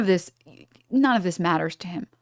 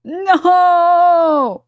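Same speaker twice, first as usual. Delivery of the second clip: long, high, loud wail of no; dramatic shout; 11 semitones higher, 9 dB louder than usual. Acted. Streamer crying out in refusal, dramatic shout. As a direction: cry it out at full force, drawn out.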